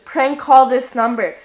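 usual speaking voice

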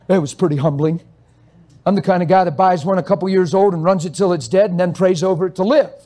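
ordinary talk, nothing marked

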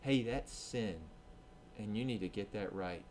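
soft, quiet conviction; quiet voice of a loving God; gentle loving correction. Retold or imitated. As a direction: correct them tenderly. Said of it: "his spirit convicts us and says".

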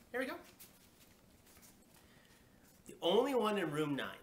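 plain reading voice

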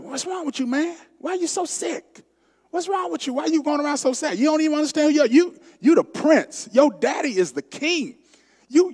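high, pushy cousin voice; Jonadab voiced. Jonadab prodding sick prince Amnon, high and pushy. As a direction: needle him like a sly cousin. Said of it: "Jonadab said".

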